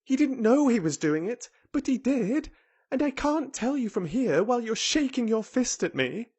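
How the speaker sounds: put-on high-pitched, insistent girl; obstinate protest. A narrator's reading. Mary arguing with fist-shaking Ben, obstinate protest. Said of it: "she protested obstinately".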